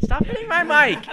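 high exasperated yell. Real, not acted. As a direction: tell them off sharply. Scolding friends over mic taps.

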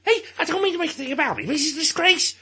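put-on Scouse accent. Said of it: "say that again in English".

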